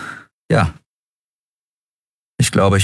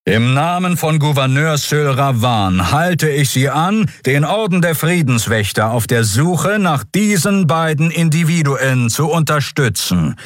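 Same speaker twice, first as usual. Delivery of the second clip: booming admiral announcement; 8 semitones higher than usual. Acted. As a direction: proclaim it over the whole boulevard. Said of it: "dröhnte die dunkle, kräftige Stimme". (der Admiral verkündet der Stadt, förmlich und getragen)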